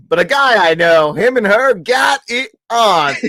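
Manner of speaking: loud, high movie-character bragging; hammed-up boasting. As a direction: crow it loudly, stretching the last words. Acting out a boastful movie quote.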